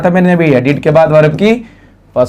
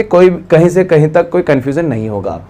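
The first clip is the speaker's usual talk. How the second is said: normal talking voice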